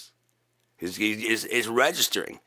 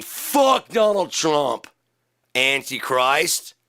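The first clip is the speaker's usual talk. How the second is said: slow shout; 3 semitones higher, much slower than usual. Acted. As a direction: drag each word out as a shout. Final shouted insult.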